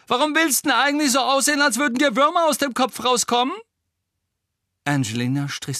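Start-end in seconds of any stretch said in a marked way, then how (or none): 0.1-3.6 fast, very high, loud shriek; screeching taunt. A narrator's reading. Pansy taunts Angelina from below, screeching taunt. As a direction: screech the insult in a rush. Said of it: "kreischte Pansy Parkinson".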